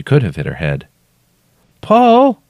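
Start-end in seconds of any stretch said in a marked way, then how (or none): 1.8-2.4 very high, loud questioning call. A narrator's reading. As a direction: call his name up from below. Trapped woman calls his name.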